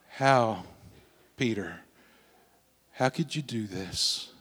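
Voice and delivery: soft, low and hurt; sorrowful, hushed. Acted. Jesus looking at Peter, soft sorrowful question. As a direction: ask it quietly, heartbroken not angry.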